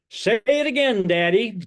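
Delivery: put-on high, loud little-boy plea; six-year-old son. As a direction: mimic a child calling eagerly to daddy. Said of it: "six years old at the time".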